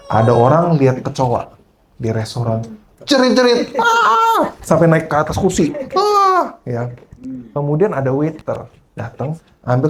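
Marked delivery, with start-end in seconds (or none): 3.1-4.5 high squealing shriek, laughing; laughing high mock shriek; mock scream. Mimicking a screaming restaurant guest. 6.0-6.6 mock scream. Mimicking a screaming restaurant guest.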